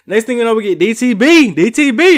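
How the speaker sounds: excited. Real, not acted. Excited to introduce favourites.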